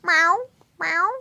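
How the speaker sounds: high, rising playful meows; mock meowing. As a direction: meow like a little kitty. Meowing at cat.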